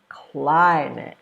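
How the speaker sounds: CLIMATE with a long, strong CLI; first-syllable stress. Shown as a demonstration. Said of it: "you stress the first one".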